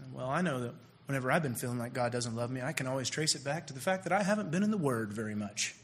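low, soft cliché-spouting believer; understated mocking recital; mock piety. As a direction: drop low and quiet for a platitude. Mimics people who throw out cliches.

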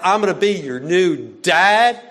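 loud, exaggerated parody rising at the end; "I'm gonna be your new dad" echoed loud and mocking, rising on "dad"; mocking repeat. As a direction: parrot the line back in ridicule. Mimicking the dorm dad's line.

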